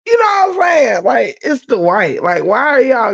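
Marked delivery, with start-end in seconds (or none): 0.1-1.0 sudden shout. Real, not acted. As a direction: jump up and yell the question at them. Ranting to the livestream chat.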